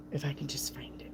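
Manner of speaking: whispered aside while searching; whispered to self. Real, not acted. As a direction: whisper it under your breath. Searching for card, muttering.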